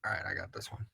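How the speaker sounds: soft whisper to cohost; whispered aside. Real, not acted. Offering to take the question, whispering to cohost. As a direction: murmur it under the breath.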